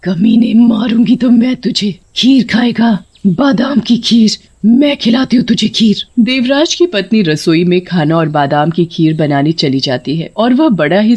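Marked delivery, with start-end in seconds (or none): 0.0-6.1 punchy, menacing witch threat; vengeful anger. Acted. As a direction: spit out each phrase in fury. Witch threatens the greedy master. (गुस्से भरी धमकी)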